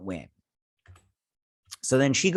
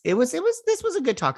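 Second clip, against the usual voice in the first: much higher, gushing excitement; excited; faster than usual. Real, not acted. Fan gushing to viewers about a scene, excited and much higher. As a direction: squeal how good that scene was.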